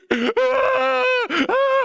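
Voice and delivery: very high, loud mock cries of pain; mock crying yell. Imitating the crying old man next to him, loud and high. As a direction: ham up an old man's pained wailing. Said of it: "he's like".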